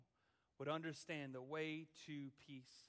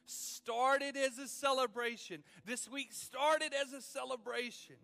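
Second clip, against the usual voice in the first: loud, high, driving sermon; full-force preaching; 5 semitones higher, 7 dB louder than usual. Acted. Pastor preaching to the church at full force. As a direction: preach it out with fire.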